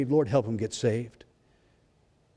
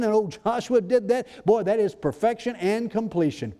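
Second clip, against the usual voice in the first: fervent preaching; 9 semitones higher than usual. Acted. Preacher fired up before the congregation, fervent preaching. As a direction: preach it out with fire.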